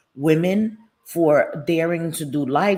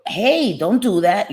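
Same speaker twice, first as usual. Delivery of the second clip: retold scolding; 4 semitones higher, 6 dB louder, slower than usual. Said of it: "I was like".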